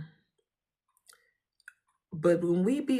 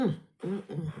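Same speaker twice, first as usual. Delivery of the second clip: ordinary talk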